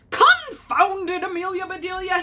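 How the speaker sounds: hammed-up grumpy boss fed up with her; annoyed outburst. A narrator's reading. Mister Rogers annoyed at raw egg.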